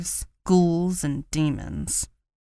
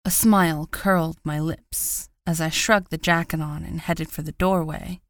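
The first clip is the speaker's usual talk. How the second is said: ordinary delivery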